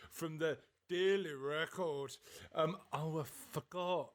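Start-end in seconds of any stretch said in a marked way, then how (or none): none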